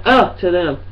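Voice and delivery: ordinary talk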